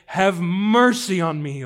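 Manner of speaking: loud, drawn-out plea; slow, impassioned cry; crying out for mercy. Acted. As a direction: stretch out a slow, pleading call. Blind man's cry acted out.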